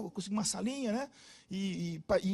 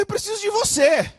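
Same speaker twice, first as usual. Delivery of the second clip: higher, louder, enthusiastic; eager declaration; 12 semitones higher, 8 dB louder than usual. Retold or imitated. Pastor telling the shy girl he needs her, eager. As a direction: say it with warm eagerness.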